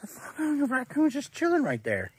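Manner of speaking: rising, startled disbelief; shocked at the raccoon; surprised. Real, not acted.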